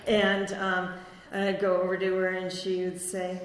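ordinary talk, nothing marked

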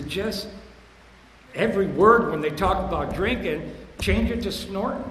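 loud, pointed advice to a cokehead friend; emphatic advice. Retold or imitated. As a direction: press the point home to his friend. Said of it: "I says".

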